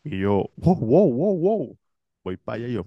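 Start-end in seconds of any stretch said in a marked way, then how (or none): 0.6-1.8 higher excited wow wow; playful astonished wows; mock excited wows. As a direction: sing out the wows in surprise. Teller's excited reaction.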